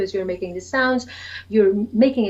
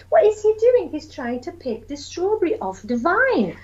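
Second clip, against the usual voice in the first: put-on high wondering child's question; 7 semitones higher than usual. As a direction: ask it as an amazed toddler. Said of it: "They say".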